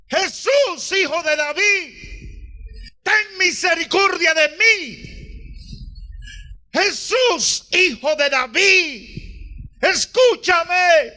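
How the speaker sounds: high, pleading cry to Jesus; a blind man's desperate cry for mercy. A narrator's reading. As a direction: call out for mercy across the crowd. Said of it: "comenzó a gritar con voz fuerte".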